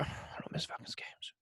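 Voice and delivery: hushed, voiceless, slow aside; whispered repeat. Real, not acted. Fan insisting to co-host he never skips a game, whispered. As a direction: whisper it slowly under your breath. Repeats it under his breath.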